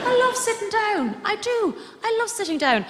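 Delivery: high-pitched mock rapture; gushing delight. Comedian confessing a love of sitting down, high gushing delight. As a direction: gush it high, nearly squealing with love. Gushes about loving sitting down.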